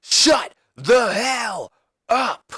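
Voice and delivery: high, stretched-out wrestler's taunt; hammed-up, slow, high-pitched demand; drawn-out catchphrase. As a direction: draw out each word like a ring catchphrase. Jericho's acted catchphrase interruption.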